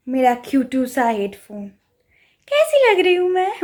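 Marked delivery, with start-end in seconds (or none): 2.5-3.6 coy question. Pretend. Showing off cute headphones.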